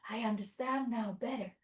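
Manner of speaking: usual speaking voice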